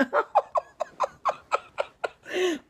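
bursts into a high squealing laugh. Real, not acted. Laughing at her own nut pun to viewers. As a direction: cracks up at her own joke.

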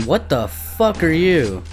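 a hammed-up moaning ghoul; mimicking monster wail. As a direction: echo the creatures' cry, slow and high. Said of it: "scream and whine".

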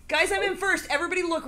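high, urgent call to the group; excited announcement. Real, not acted. Player telling friends of a race lead, excited.

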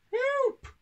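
tiny, very high mouse yelp; squeaked. A narrator's reading. Country mouse dodging stamping feet, squeaked cry for help. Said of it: "squeaked Pippin".

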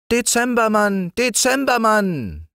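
hoch und gedehnt rufen; laut nach der Spinne rufen; rufende Kinder. A narrator's reading. (Kinder rufen die Spinne hoch und gedehnt)

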